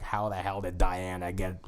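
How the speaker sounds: half-hearted spouse impression; wife impression. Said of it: "this is my wife's voice now".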